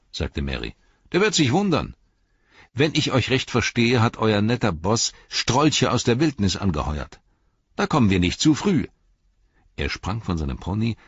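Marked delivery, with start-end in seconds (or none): 1.1-8.9 loud, much higher defiance; loud cocky hobbit; defiant boast. Acted. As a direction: relish the coming fight. Merry defiant.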